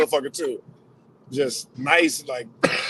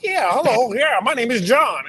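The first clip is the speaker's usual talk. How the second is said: higher, eager polite intro; 7 semitones higher than usual. Retold or imitated. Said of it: "just like".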